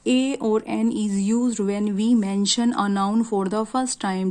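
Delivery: very slow reading of a grammar rule; slow teacher reading from the board; slow rule dictation. Shown as a demonstration. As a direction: dictate the rule slowly for notes. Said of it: "कहाँ पे use किया जाता है".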